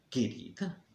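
incredulous questioning, rising at the end; suspicious echo. Acted. Jealous man catches José's endearment, suspiciously questioning it. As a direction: throw the endearment back as a soft question.

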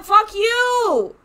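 loud, high shouted insult; furious yell back at the screen; angry shout. Real, not acted. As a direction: shout it straight at the game. Arguing with the game character.

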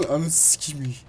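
ordinary talk, nothing marked

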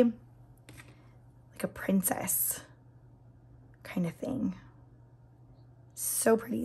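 soft dreamy whisper. Real, not acted. Nail vlogger describing princess polish, whispering. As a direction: hush it like a fairy tale.

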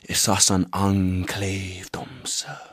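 slow, hushed whisper; whispered refrain. Acted. Performer confides the enclave refrain to the audience, whispering slowly. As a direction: drop right down to a slow whisper.

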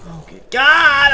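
high, loud outburst; exasperated cry. Real, not acted. Player not wanting to deal with an enemy, exasperated cry. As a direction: cry out, fed up.

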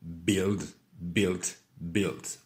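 "Build, built, built" with a plain short i, no u; silent U. Shown as a demonstration. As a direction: model "Build" as bild and "built" as bilt. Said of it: "On ne prononce pas le U".

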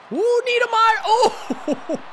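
loud, high whooping reaction; excited outburst. Real, not acted. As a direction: cry out high at a big play. Reacting to a big play.

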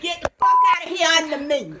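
loud, high, quick order; furious rapid yell; angry dismissal. Real, not acted. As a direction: shout them away, fed up. Angrily ordering the prankster away.

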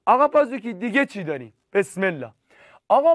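ordinary talk

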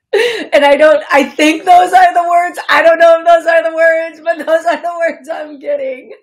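loud, high laughing talk. Real, not acted. Medium laughs at her own bad singing, loud and high.

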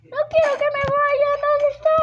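loud, high-pitched mock panic; play panic. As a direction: cry out high and loud, playing scared. Play panic as police come. (pánico fingido, agudo y fuerte)

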